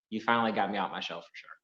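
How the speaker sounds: normal talking voice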